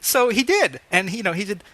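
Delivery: ordinary delivery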